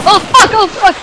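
high, loud, alarmed swearing; frantic outburst; panicked shout. Real, not acted. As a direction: yell it as danger jumps out. Panicking under attack in game.

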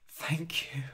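breathy grateful whisper; tender whisper. Acted. Jasper whispers thanks to dying Harold, tender whisper.

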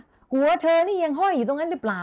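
high, loud teasing shout; strong roar. Shown as a demonstration. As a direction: boom the question like a jolly ghost. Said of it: "แรงมากนะคะ". (ตะโกนแซวเสียงสูงดัง)

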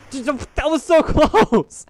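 high, laughing disbelief; relieved shout. Real, not acted. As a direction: squeal it out, barely survived. Nearly falling off bridge.

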